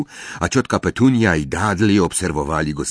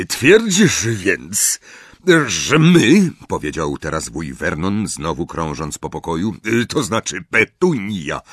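gruff uncle; 5 semitones higher than usual. A narrator's reading. Uncle interrogating Harry in a bluster. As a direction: bluster suspiciously.

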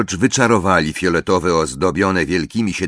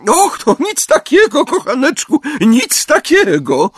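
fond, affectionate; 5 dB louder than usual. A narrator's reading. Mrs Weasley to Harry, fond and doting. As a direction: coo it like a proud mother. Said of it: "odpowiedziała pieszczotliwym tonem".

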